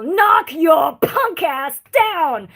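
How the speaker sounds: slow, loud tough-guy shout; tough cop shout. Retold or imitated. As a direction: bark it like a hard street cop. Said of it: "my brain goes".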